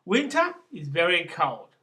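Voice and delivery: emphatic recital with a lifted "Winter"; poem opening. Acted. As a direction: announce it like a poem's opening, punching "Winter". Opens the poem, declaiming its line.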